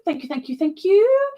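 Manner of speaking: very high squeaky thank you. Real, not acted. Streamer thanking chat in a high squeaky voice. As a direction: pitch the thanks up playfully.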